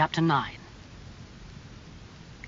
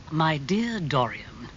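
soothing; slower than usual. Acted. Lord Henry answers panicking Dorian, soothingly. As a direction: linger on it to calm him.

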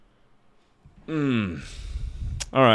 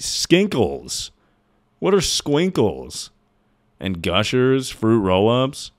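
ordinary talk

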